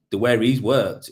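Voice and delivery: ordinary delivery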